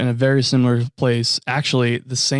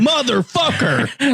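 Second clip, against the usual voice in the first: slow, high, stretched motherfucker; hammed-up exasperated curse; drawn-out mock curse; 12 semitones higher, much slower than usual. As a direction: draw out the curse in disbelief. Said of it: "I'd be like".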